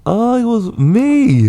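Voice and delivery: very high, slow silly voice; put-on voice. Answering co-host about the voice, high silly voice. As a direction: pitch it way up and draw out each word. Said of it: "What was that voice?".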